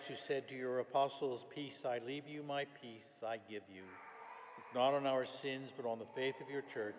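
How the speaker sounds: ordinary talk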